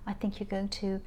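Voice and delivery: ordinary talk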